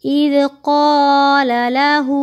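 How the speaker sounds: slow chanted recitation, heavy qaf; stretched tajweed chant; Quranic recitation. Shown as a demonstration. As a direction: recite slowly and melodically. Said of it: "कैसे?".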